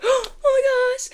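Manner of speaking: very high, squeaky gushing; mock giddy amazement. Watching her own videos with viewers, giddy squeal. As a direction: squeal it like a thrilled fan, playacted.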